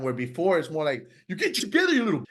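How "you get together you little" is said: much higher, strained mock scolding of himself; mean inner critic, high and tight; harsh self-scolding. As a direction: bark at yourself like a mean inner voice. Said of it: "this is how I talk to myself".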